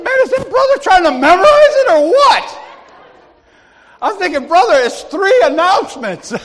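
very high, loud mock disbelief; incredulous outburst. Usher teasing [name] over three announcements, incredulous outburst. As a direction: cry it out, astonished and amused.

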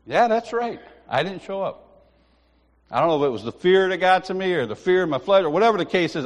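usual speaking voice

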